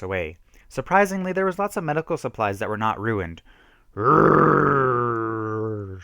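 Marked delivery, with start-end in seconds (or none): 4.0-6.0 loud raging growl-scream; angry frustrated scream. A narrator's reading. Kenny throws medicine bottles, raging roar. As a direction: let out a furious roar. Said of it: "screamed Kenny".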